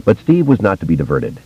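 ordinary delivery, nothing marked

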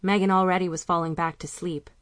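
usual speaking voice

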